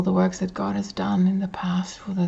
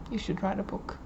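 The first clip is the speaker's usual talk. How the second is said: soft, wry echo of advice; quiet, thrown-away aside; musing aside; 10 dB quieter than usual. Acted. Recalls friend's remark to himself.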